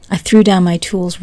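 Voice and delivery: ordinary delivery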